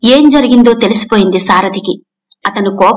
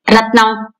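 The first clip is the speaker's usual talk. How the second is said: irritated; slower than usual. A narrator's reading. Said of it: "విసుగ్గా అన్నారాయన".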